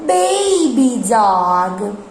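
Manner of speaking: put-on "Baby dog." said slowly and clearly; slow model word. Shown as a demonstration. Teacher models "Baby dog." slowly for repeating. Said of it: "Can you say that?".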